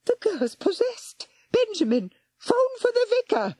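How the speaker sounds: put-on very high panicked old lady; frightened scream. A narrator's reading. Old lady screaming about the possessed girl. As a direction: shriek it in fright. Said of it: "screamed in fright".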